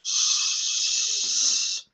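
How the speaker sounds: voiceless shushing hiss; a long hushing shh. Shown as a demonstration. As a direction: shush them quiet. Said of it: "She be telling motherfuckers, "Shush".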